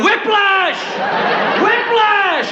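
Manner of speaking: high, mock pained yell; hammed-up high yell of Whiplash. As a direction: cry it out like a faker hit by a car. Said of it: "And then you yell".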